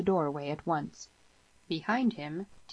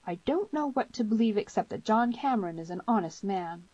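higher-pitched, earnest female character; troubled girl; 6 semitones higher than usual. A narrator's reading. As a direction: insist earnestly in a girl's higher voice.

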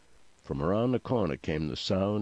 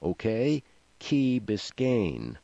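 higher raised voice, slowing down; restrained, drawn-out narrated shout; mild shout; 4 semitones higher, slower than usual. A narrator's reading. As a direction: call it out slowly so it sinks in. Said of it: "shouted Kevin".

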